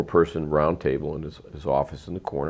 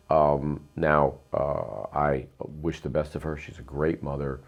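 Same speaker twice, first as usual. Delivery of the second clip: ordinary talk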